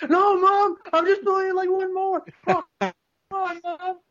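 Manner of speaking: very high, loud child impression; mock pleading kid. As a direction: plead like a child caught gaming.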